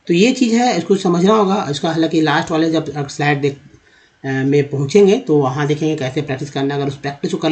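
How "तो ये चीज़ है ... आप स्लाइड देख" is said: usual speaking voice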